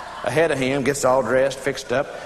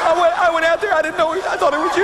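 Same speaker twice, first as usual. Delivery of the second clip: high-pitched mock hysterics; panicked confession; 10 semitones higher than usual. Wife confessing to husband what she did, panicked. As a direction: babble the excuse way up high.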